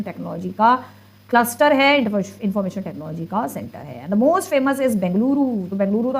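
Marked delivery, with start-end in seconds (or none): none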